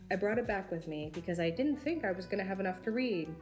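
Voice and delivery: ordinary talk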